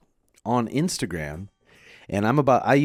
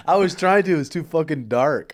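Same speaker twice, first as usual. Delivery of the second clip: laughing; 7 semitones higher, 7 dB louder than usual. Real, not acted. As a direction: still laughing, pitched up. Laughing while telling the story.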